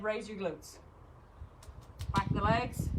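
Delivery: usual speaking voice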